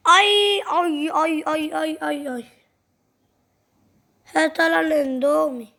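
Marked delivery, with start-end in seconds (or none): 0.1-0.6 sudden higher, louder ouch; short mock cry of pain; pain yelp. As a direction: yelp as if just hit. Kid hurt by the bully.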